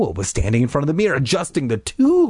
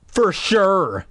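drawn-out higher "for sure"; eye-rolling sarcasm; 4 semitones higher than usual. Acted. Sister doubting her brother's excuse, sarcastic. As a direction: drag it out mockingly. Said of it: "rolling her eyes".

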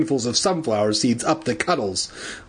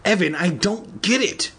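higher-pitched baffled protest; mildly acted exclamation; confused cry; 8 semitones higher than usual. A narrator's reading. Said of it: "Andy cried".

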